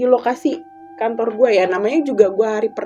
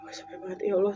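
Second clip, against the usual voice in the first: exhausted sigh; 3 semitones lower, 12 dB quieter, faster than usual. Retold or imitated. Exhausted prayer after nightmare.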